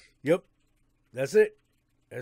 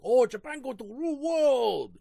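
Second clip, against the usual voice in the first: high, much louder hammed-up Chinese accent; mock Chinese accent; 8 dB louder than usual. Friend mocks eighties Japan in a hammed-up Chinese accent. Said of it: "stereotypical Chinese accent".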